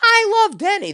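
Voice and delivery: jumps way up high, gushing; gushing emotional quote. Retold or imitated. Mocking an emotional speaker, gushing high. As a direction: sell it like a swooning fan.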